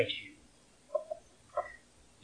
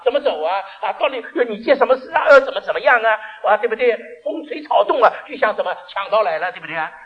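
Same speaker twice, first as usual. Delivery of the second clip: panicked worry; 7 semitones higher, 9 dB louder than usual. Acted. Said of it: "他想着".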